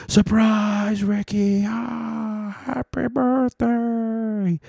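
very high, drawn-out party yell; mock surprise cheer. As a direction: imitate coworkers springing a surprise, stretching every word. Coworkers spring surprise party.

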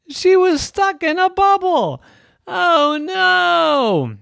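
high, loud alarmed cry. Acted. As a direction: panic as she's trapped. Trapped in bubble, alarm.

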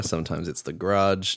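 low, soft croaking grudge. Retold or imitated. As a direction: rasp it out like the Grudge ghost. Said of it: "depends what mood she's in".

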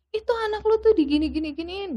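fast, high-pitched mock outrage; retold angry mother. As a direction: voice a mother's indignant accusation. Retold angry mother confronting father.

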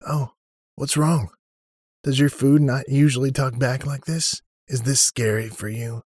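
high, loud, sing-song mockery; mocking taunt. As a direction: needle him like a bully. Sam mockingly taunts Odon.

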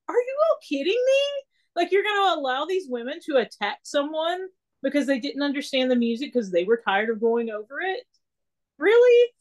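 outraged disbelief. Retold or imitated. To the pastor calling her a crybaby, outraged disbelief. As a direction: let the indignation climb into an incredulous really.

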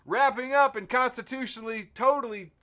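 high, loud showman's announcement; grand sign-off. Acted. Host wraps up the episode for listeners, grand sign-off. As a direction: belt out the wrap-up in mock grandeur.

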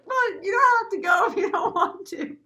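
very high, loud mock panic; panicked backpedaling. Head usher to crying Kevin, loud high panicked reassurance. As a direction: back off in a hammed-up high fluster. Frantically backing off crying coworker.

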